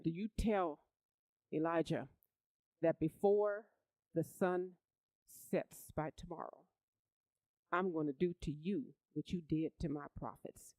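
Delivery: ordinary talk, nothing marked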